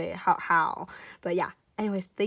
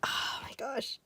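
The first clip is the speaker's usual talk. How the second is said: awed whisper; 3 semitones higher, 8 dB quieter, slower than usual. Real, not acted. Vlogger admiring the colouring, awed whisper. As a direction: breath-only gush of amazement.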